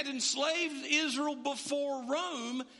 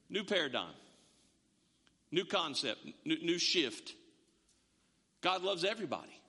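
calm; 8 semitones lower than usual. Retold or imitated. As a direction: gently state the new idea to Nicodemus. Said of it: "just calmly looks at Nicodemus and says".

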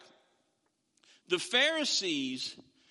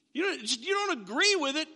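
mock disbelief; 7 semitones higher than usual. Teacher's shocked reaction.

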